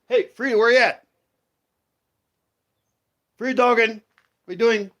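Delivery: high, loud call across the yard; loud sing-song dog call. Real, not acted. Calling the dog from afar.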